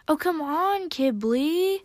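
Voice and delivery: high, loud, wheedling protest; playful, exasperated coaxing; half teasing. A narrator's reading. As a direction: cut in, teasing a friend. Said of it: "half being reasonable and the other half teasing".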